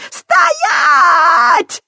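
put-on high, loud shrieking old woman; frantic scream. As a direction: scream it at full force. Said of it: "истошно завопила старуха". (истошный вопль старухи)

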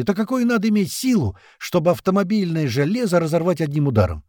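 high-pitched heated objection; indignant outburst. A narrator's reading. Колючий disputes the claw theory, indignant. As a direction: argue back, worked up and high. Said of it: "взвился Колючий".

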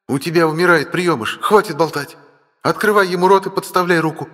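urgent command. Acted. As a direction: order her sharply and urgently.

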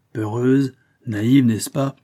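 ordinary delivery, nothing marked

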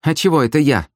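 high, pouting objection; sulky. A narrator's reading. Sister ordered to row by her brother, sulky. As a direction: object with a high-pitched pout. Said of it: "насупилась сестра".

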